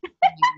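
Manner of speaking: a quick high burst of laughter; sudden high giggle; bright laugh. Real, not acted. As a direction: laugh it off, amused at yourself. Laughs at her own stumble.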